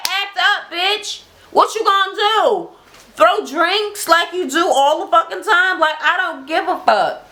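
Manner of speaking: high, goading challenge; high-pitched dare; defiant taunt. Retold or imitated. Minnie daring Juicy to act up, high and taunting. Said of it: "she was like".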